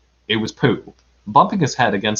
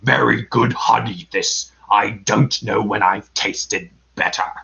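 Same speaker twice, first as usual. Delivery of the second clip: Heffalump savoring honey; 3 semitones lower than usual. A narrator's reading. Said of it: "licking its jaws and saying to itself".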